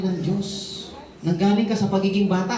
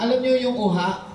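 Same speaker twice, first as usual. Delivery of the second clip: ordinary talk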